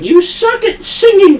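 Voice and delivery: high, mocking nag about her singing; mocking manager. Manager sneering at the girl's singing, mock high voice. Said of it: "she'd be like".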